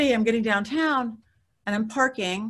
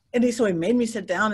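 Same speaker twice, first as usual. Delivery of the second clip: ordinary delivery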